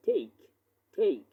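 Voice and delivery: টেক as "theyk", puff after t; smooth aspirated T. Shown as a demonstration. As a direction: release breath smoothly on the t of টেক. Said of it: "smoothly বলতে হবে".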